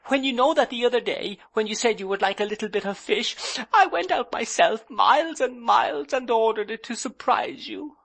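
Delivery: high, quick hurt pleading; girlish bride protesting through tears; aggrieved young wife. A narrator's reading. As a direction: rush out the proof she tried. Dora pleads her case.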